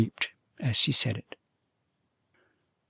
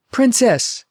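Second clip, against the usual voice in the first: higher, louder call of her name; repeated call; 11 semitones higher, 6 dB louder than usual. A narrator's reading. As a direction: call her again, eager. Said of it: "repeated the prince".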